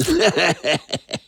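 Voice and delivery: short gleeful cackle; gleeful laugh. Acted. Villain laughing over his kill, gleeful cackle. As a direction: laugh with cruel delight. Gloating after stabbing.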